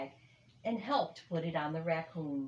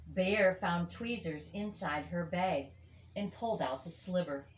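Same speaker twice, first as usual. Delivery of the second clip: ordinary delivery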